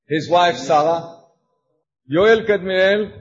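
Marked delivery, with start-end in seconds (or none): none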